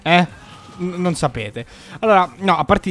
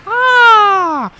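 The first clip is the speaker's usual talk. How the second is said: sudden high yelp of surprise; pained yelp; 10 dB louder than usual. Real, not acted. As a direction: cry out as you get hit. Character smashed away.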